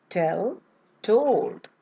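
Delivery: "tell" rising, "told" falling; model pair tell told. Shown as a demonstration. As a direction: set "tell" up with a rise, land "told" with a fall. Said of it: "second word pair is".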